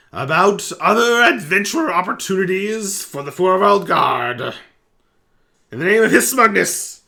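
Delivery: high, loud, proud goblin voice; goblin captain. Acted. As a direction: bellow it like a pompous little goblin captain.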